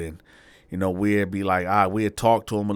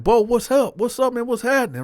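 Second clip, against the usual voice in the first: very high, loud hail; excited greeting; 6 dB louder than usual. Retold or imitated. Teller retells hailing Bo in prison, excited. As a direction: greet him eagerly, pitched way up.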